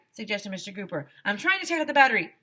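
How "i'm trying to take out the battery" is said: fast, high, louder frantic reply; fast, loud frantic teacher; flustered. A narrator's reading. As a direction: rush it like a harried teacher. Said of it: "said Missus Meyer".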